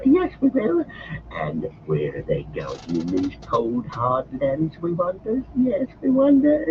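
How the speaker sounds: high, slow creature voice, fawning; Gollum's voice. Acted. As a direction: coax them, sly and eager. Gollum offers to guide.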